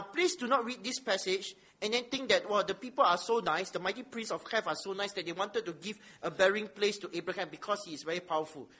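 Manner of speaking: ordinary talk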